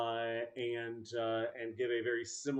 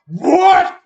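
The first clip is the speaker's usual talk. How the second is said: loud, high shout, repeated; the GM echoes the player's loud shout around the room; echoing shout; 13 semitones higher, 21 dB louder than usual. Retold or imitated. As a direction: yell it like it echoes off the walls. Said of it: "as you shout, it goes".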